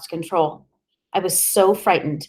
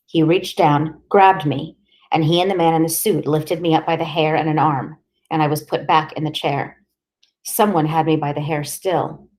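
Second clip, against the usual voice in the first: plain reading voice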